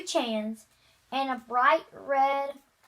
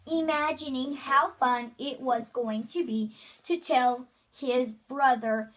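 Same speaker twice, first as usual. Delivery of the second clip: usual speaking voice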